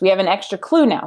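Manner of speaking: normal talking voice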